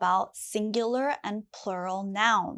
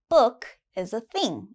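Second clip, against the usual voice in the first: normal talking voice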